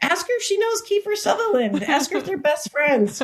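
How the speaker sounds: high coyote gossip voice; nosy coyote asking around; high pitched gossipy coyote. Pretend.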